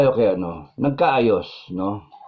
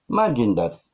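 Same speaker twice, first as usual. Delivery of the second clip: ordinary talk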